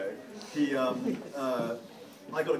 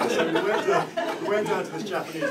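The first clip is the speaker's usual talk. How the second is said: laughing; 5 semitones higher, 7 dB louder than usual. Real, not acted. Laughing through the story.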